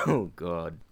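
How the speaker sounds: normal talking voice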